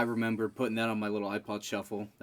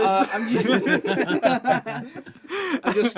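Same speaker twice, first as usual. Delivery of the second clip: laughing through an apology; words breaking up with laughter; 12 semitones higher, 7 dB louder than usual. Real, not acted. Laughing at himself while correcting facts.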